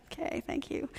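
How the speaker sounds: acted hushed, dutiful gratitude; muted polite reply. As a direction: murmur a half-hearted thank-you. Acts out the weary recipient.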